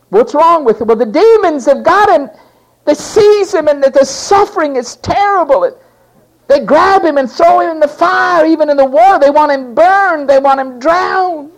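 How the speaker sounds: high, loud, urgent outcry; father begging Jesus about his son, high and loud; desperate father. Acted. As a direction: pour out the father's panic. Father pleads about demons.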